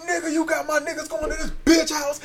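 high angry scold. Retold or imitated. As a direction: scold him high and furious. Friend scolding retold.

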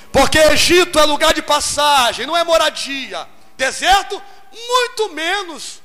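loud, high preaching; preaching hard. Real, not acted. Preacher stirs the congregation at full force. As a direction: drive the point home to the crowd.

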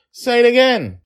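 put-on very high, loud child's voice; gleeful kid insisting on a repeat; squealed playful command; demanding little girl. A narrator's reading. Daughter demanding the funny word.

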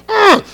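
loud, high frustrated ugh; angry grunt. Retold or imitated. Father recalling his anger on the drive, a loud frustrated ugh. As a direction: let out a burst of fury. Retelling anger driving to school.

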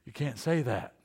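gasp, then a hushed scolding; quiet startled child scolding; shocked gasp. Retold or imitated. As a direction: mimic a shocked child catching a bad word.

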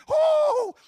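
very high, loud whoop; a loud delighted woo; joyful whoop. Real, not acted. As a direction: let out a victory cheer. Celebrating the proposal.

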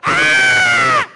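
long, very high, loud scream; exasperated scream. Acted. Preacher fed up recounting the trip, exasperated scream. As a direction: scream as if overwhelmed by it all. Overwhelmed scream ending a rushed travel rant.

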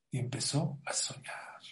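whispered, breathy hush; whispering. Acted. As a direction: drop to a secretive whisper. Hushed storytelling moment.

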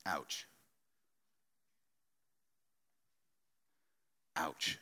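quiet, rueful ouch; a hushed wince of an ouch; soft ouch. Real, not acted. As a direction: let it sting, barely said aloud. Rueful self-conviction.

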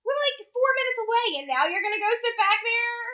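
high, mock-incredulous question; incredulous retort. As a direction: pitch it up in exaggerated disbelief. Retold friend's incredulous retort.

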